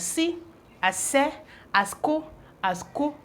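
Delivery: si, se, ko as separate syllables; "si", "se", "ko", each a short open syllable; sounding out C. Shown as a demonstration. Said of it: "C can be pronounced as".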